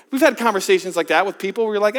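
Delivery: ordinary talk, nothing marked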